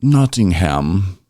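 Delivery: put-on high, drawn-out British accent. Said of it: "do a British accent".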